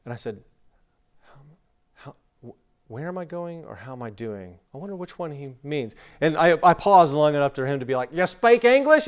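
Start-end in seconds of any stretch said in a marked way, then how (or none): 1.2-5.9 very soft, halting thinking aloud; puzzled muttering. Acted. Baffled traveler wondering what the Australian means, hushed and puzzled. 8.1-9.1 loud, high Australian question; boisterous Australian. Retold or imitated. Australian seatmate checking on the silent American, loud mock Australian accent. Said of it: "this boisterous Australian".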